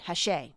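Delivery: Hachette said "ha-SHAY"; French pronunciation. Shown as a demonstration. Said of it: "that is pronounced Hachette".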